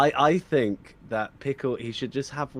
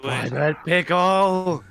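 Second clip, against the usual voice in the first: announcer voice; 6 semitones higher, 6 dB louder, much slower than usual. Pretend. Proudly announcing the character's name.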